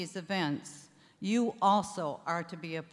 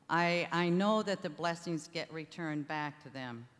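ordinary talk

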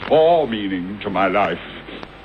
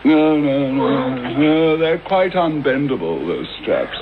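gloating taunt; 5 semitones higher than usual. Acted. Watson taunting bound Holmes.

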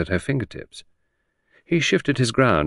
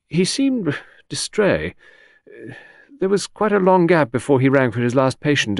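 higher secretary voice, hesitating; wavering confidence; 8 semitones higher, 3 dB louder than usual. A narrator's reading. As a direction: less confident, searching reply. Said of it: "the confidence of Beryl's replies wavered".

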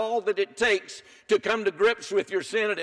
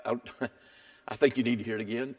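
normal talking voice

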